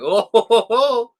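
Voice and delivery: high, loud excited whoops; rising "oh ho ho". Retold or imitated. Fan reliving a film line, hooting "oh ho ho". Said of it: "I was like".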